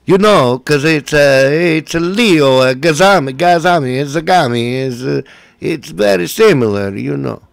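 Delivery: comic Italian-accented priest impression; Father Guido Sarducci. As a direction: ramble like a hammed-up Italian priest. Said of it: "Father Guido Sarducci".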